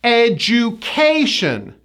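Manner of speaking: "Education" slow, stress on KAY; third-syllable stress. Shown as a demonstration. Teacher models the stress, slow ed-joo-KAY-shun "Education". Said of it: "The third syllable is stressed".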